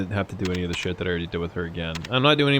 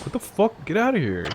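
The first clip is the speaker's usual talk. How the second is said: indignant passerby; 9 semitones higher than usual. Retold or imitated. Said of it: "This guy's like".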